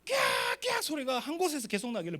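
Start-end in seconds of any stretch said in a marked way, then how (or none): 0.1-0.6 loud screech like screaming riders; imitated scream. Pastor imitating screams heard at the park, mock shriek. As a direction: mimic a shriek from the rollercoaster. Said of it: "곡소리가 거기서만 나요".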